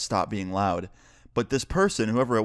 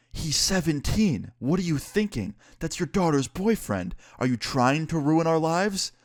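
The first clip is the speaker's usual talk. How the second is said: high, drawn-out retold reproach; imitated husband, appalled and pleading; exasperated scolding; 7 semitones higher than usual. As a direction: scold in pitched-up disbelief, stretching the words. Husband scolds wife in retelling.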